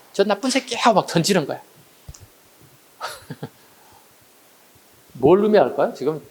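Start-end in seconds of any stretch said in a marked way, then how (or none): 0.1-0.8 mock yell. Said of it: "돌멩이를 막 집어던져".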